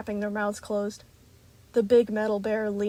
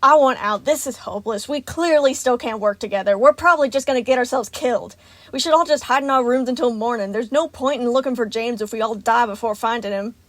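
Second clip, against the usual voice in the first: loud, fed-up outburst; frustrated, hurried tirade; angry yelling; 3 semitones higher, 8 dB louder than usual. A narrator's reading. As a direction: argue it hotly, wanting out. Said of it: "Monty yelled".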